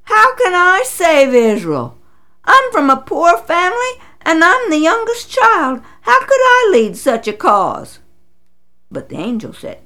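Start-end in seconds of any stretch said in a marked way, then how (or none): 0.0-8.0 higher, louder panicked protest; a young man stunned, pleading he is too small; blurting. Acted. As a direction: blurt it out in disbelief. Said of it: "He blurted".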